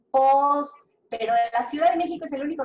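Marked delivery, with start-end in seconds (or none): 0.1-0.7 "pos" with an o in place of the diphthong; "pos", louder, the S kept; vowel-changed pues. Shown as a demonstration. Teacher giving another variant, "pos". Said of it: "será pus".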